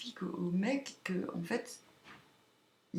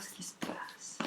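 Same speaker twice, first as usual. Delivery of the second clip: whispering; 13 dB quieter than usual. Real, not acted. Whispering before tarot draw.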